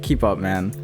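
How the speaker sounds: usual speaking voice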